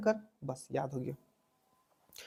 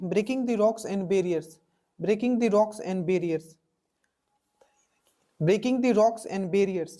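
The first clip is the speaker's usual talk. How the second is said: title dictated very slowly; slow dictation; much slower than usual. Shown as a demonstration. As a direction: spell it out word by word for the class. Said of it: "dictating the title". (बहुत धीरे बोलकर लिखवाना)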